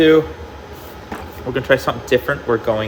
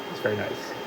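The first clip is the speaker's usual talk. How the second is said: much softer, muttered repeat; quiet echo; 3 semitones lower, 11 dB quieter than usual. Real, not acted. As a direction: say it again under your breath. Repeats praise, then echoes softer.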